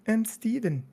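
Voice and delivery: quick high British "I'm Steven" in a soft, distant timbre. Retold or imitated. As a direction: flip into the mild-mannered British Steven. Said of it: "his face just would change from, like, a Mark to".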